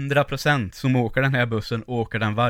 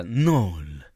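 telefonsvarare; 5 semitones lower than usual. Retold or imitated. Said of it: "Som telefonsvarare när de ska säga nummer".

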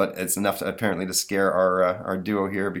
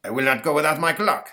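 put-on French accent; 7 semitones higher, 4 dB louder than usual. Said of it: "says Napoleon Ghost".

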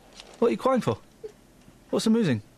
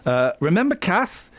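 ordinary talk, nothing marked

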